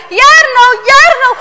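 high, loud urgent shout of a name. A narrator's reading. Father coming closer shouts for Jarno, high urgent call. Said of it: "Vaders stem klinkt steeds harder".

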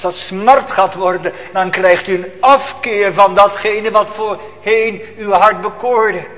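loud preaching, pressing the congregation; preacher warning the congregation about sin, loud and urgent; fiery preaching. Acted. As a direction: thunder it from the pulpit. Preaching at full force.

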